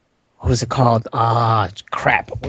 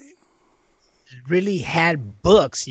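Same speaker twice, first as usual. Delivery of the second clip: mock amazement; 4 semitones higher, much slower than usual. Real, not acted.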